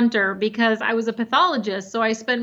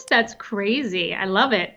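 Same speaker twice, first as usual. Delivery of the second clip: normal talking voice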